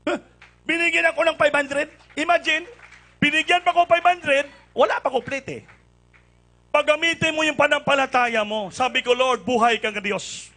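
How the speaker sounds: loud, high, bursting with excitement; excited. Real, not acted. Preacher tells the congregation about the gift, excited and loud. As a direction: tell it like you can't believe your luck. Excited retelling of receiving money.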